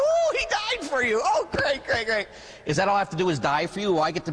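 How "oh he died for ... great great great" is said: high squealing fake thrill; hammed-up gushing, much higher pitched; mock excitement. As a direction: pretend to be wildly excited. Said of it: "I get all excited".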